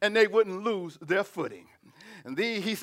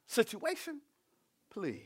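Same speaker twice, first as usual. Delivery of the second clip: soft dismissal; 12 dB quieter than usual. Real, not acted. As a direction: wave it away under your breath. Dismisses trouble after loud preaching.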